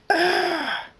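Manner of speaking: very high, loud pained scream; sudden high scream at being struck. A narrator's reading. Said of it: "Thunderstorm screams".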